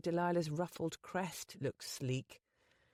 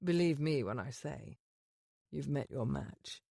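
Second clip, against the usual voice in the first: lower, cool aristocratic lord; the Duke's voice; 4 semitones lower than usual. Acted. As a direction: speak down to her as a proud duke. Duke's dialogue.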